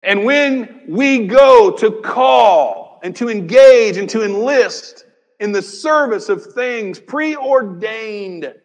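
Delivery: loud, slow, drawn-out proclamation; preaching at full force; preaching build. Real, not acted. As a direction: build it like a rallying call.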